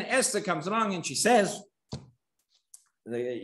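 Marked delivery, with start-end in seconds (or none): none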